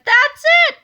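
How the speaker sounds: high, loud eureka shout; cried out. A narrator's reading. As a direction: burst out with the big idea. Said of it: "cried George".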